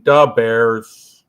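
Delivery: Da Bears with a thick Chicago da; a loud hammed-up Chicago Da Bears; Chicago accent. Quoting the SNL sketch, mock Chicago accent. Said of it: "Saturday Night Live routine".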